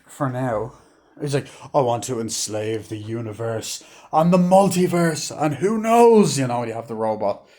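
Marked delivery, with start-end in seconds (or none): 1.7-6.4 slow start, building high and loud; mock villain proclamation. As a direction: build to a hammed-up megalomaniac cry. Quoting villain's grandiose speech.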